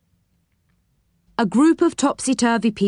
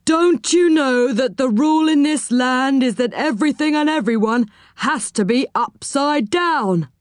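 officious scolding in a character voice, higher pitched; stern, raised-pitch telling-off; stern policeman; 4 semitones higher than usual. A narrator's reading. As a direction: lay down the rule sternly. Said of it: "he asked in a stern voice".

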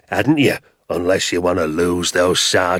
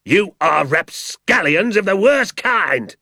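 much higher, slow indignant shout; furious shout; 12 semitones higher, 4 dB louder, slower than usual. A narrator's reading. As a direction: spit out the insult. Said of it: "shouted Mister Hazel".

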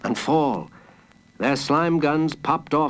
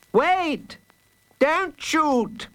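high-pitched frightened cry; terrified pleading; 12 semitones higher, much slower than usual. A narrator's reading. Mister Fox begging not to be shot, terrified. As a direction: plead high, as if under fire. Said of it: "pleaded a terrified Mister Fox".

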